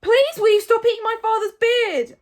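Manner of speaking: high, full-volume plea; desperate polite shout; Hiccup yelling. A narrator's reading. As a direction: yell as loudly as you can. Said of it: "yelled as loudly as he could".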